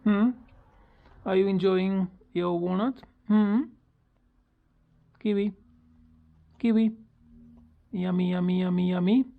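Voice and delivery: cooing pet talk. Real, not acted. Owner coos at bird eating walnut, high sing-song.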